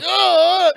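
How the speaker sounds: high, loud mock "oh!"; imitated fan squeal. Imitating people crowding a star.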